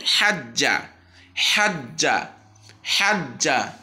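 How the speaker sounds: হাজ্জা with the doubled j held long, slow; tashdid drill. Shown as a demonstration. Teacher drills tashdid for students, clear recitation. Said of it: "জিম তাসদিদ এবং জবর যুক্ত".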